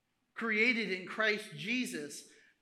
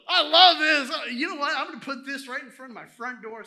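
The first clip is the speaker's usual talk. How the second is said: loud, high burst of delight; excited admiration; 6 semitones higher, 15 dB louder than usual. Acted. Showing off art for the front door, excited. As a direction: gush over a prized possession. Acts out delighted owner of artwork.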